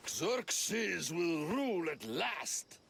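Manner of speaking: slow, loud, booming royal declaration; drawn-out regal cry of victory; grand proclamation. Acted. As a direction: proclaim it like a triumphant king. Deluded actor proclaims himself king.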